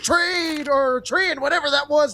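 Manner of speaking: high, hyped caster call; excited. Real, not acted. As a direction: yell the clutch play. Clutch round, caster hyped.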